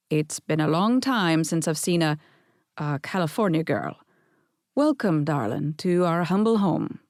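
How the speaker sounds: lightly done Scottish grandfather, charming; a hint of Scottish brogue flirting; gentle Scottish old man welcome; Scottish accent. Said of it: "right off a plane from Scotland".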